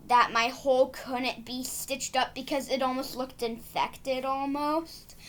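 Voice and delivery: ordinary talk, nothing marked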